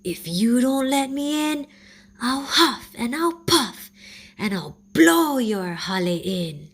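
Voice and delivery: high, loud monster threat; Mo'o threatening the piglets' tree house, loud and menacing; threatening lizard. A narrator's reading. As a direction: huff out the threat like a big bad beast. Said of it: "Mo'o answered".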